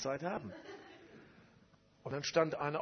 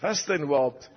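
loud retold question; 14 dB louder than usual. Retold reply to pastors.

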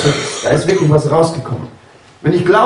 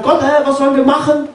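high, frantic plea in panic; desperate Moses pleading high; panicked cry to God; 7 semitones higher than usual. Retold or imitated. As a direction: cry out to God, cornered between the sea and the army. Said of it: "schreit auch zu Gott und sagt".

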